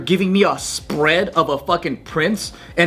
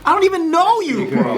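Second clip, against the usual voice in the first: high mock echo; 11 semitones higher than usual. High mocking echo of the line.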